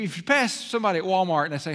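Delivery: ordinary talk, nothing marked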